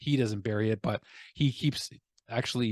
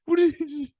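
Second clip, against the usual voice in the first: much higher, slow squeaky voice, baffled; high squeaky bafflement; slower than usual. Acted. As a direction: squeak it out like a bewildered cartoon. Mock squeaky bewildered voice.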